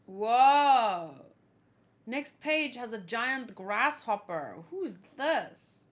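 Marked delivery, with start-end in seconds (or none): 0.1-1.1 a loud, startled whoa; amazed whoa. Real, not acted. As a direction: gasp at the new sight out loud. Startled by new game scene.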